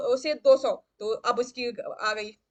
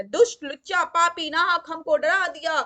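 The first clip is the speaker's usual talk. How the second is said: angry scolding; 4 semitones higher, 6 dB louder than usual. Acted. King scolds startled minister.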